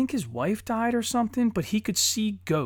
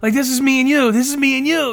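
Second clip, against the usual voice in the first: loud, higher-pitched retold excitement; excited gushing; 6 semitones higher, 8 dB louder than usual. Dad retelling his excitement to his kid, loud excited gushing. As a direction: gush it like a thrilled dad. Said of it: "And I'm all fucking excited".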